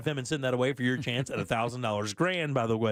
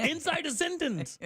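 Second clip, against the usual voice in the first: high incredulous; 13 semitones higher than usual. Real, not acted. Laughing at own gaffe.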